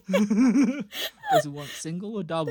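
slow, high and giggling; barely getting it out through laughter; mock server line dissolving into laughs; laughing mock question. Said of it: "And it's like".

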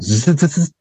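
rapid buzzing zip-tie ratchet; zippy "zzz" noise; imitated zip-tie zzz. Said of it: "It went".

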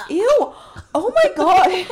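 high, loud grossed-out squeal; loud laughing ew of disgust; disgusted shriek. Real, not acted. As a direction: shriek in disgust at what you just saw. Reacting to friend gagging.

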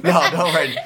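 laughing. Real, not acted. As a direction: chuckle through the words. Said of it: "laughing echo".

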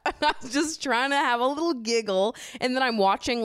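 laughing in disbelief. Real, not acted. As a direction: giggle through the words. Laughing outrage at the show.

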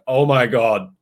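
loud retold burst of amazement; loud, awestruck reaction in a retelling; amazed exclamation. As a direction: exclaim it, recreating your astonishment.

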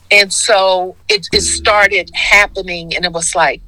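normal talking voice

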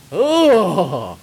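high, loud groan of disgust; disgusted ugh. Acted. Narrator sipping burned coffee, disgusted ugh. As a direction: recoil from a foul taste.

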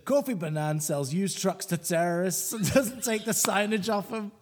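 hammed-up Scottish accent; mock Scottish accent. Co-host reading a listener's hate poem, mock Scottish accent. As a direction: read it out in a broad joke Scottish accent. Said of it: "offensive Scottish accent now".

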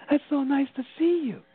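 high, lilting retold greeting; lightly acted happy hello; delighted greeting. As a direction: greet a friend with lifted pitch. Cheerfully greeting her from out of body.

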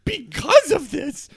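loud, high flustered protest; high whiny kid voice. Acted. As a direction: insist it happened before. Kid insists loudly.

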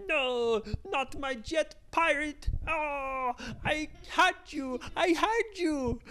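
a hammed-up villain losing his jet; dismayed villain. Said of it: "he was going".

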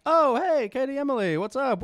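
high, chirpy retold hello; breezy greeting. Greeting the twins outside church, breezy and high. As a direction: call out a casual hello, pitched well up.

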